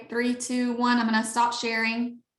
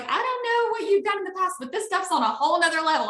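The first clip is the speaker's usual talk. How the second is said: put-on much higher, gushing teen niece; excited niece quote; 8 semitones higher than usual. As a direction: gush like a thrilled teenager raving about it. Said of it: "And she was like".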